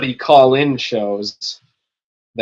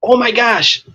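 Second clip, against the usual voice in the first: excited; 10 semitones higher, 3 dB louder than usual. Real, not acted. Excited by drinking game idea.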